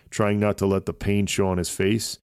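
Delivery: plain reading voice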